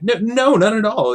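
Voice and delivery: high, loud hurried denial; a hobbit blurting out a denial; panicked. Acted. Bilbo denying.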